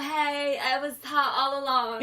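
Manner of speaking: ditzy movie heroine, light imitation; hammed-up movie makeover girl. Nerdy girl after makeover.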